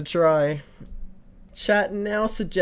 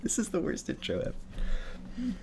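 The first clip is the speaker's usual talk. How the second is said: soft amused aside; 7 semitones higher, 10 dB quieter than usual. Real, not acted. Embarrassed after silly intro.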